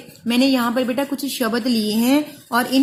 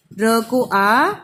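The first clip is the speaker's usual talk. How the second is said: slow spelling-out; 2 semitones lower, much slower than usual. Shown as a demonstration. Teacher spelling out राम slowly for pupils. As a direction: stretch र को आ slowly. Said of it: "तो देखिए हम कैसे लिखेंगे".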